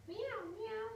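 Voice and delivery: high little mewing meow; high kitten meow. Acted. Cat sound in picture book.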